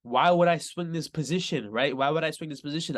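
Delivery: usual speaking voice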